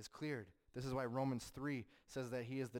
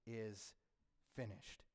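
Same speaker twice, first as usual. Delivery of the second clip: solemn final words; 3 semitones lower, 9 dB quieter than usual. Acted. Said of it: "he says".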